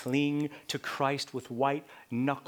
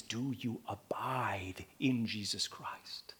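whisper; 6 semitones lower, 9 dB quieter, slower than usual. Real, not acted. Whispered searching question.

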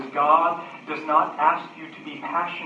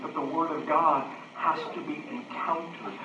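ordinary talk

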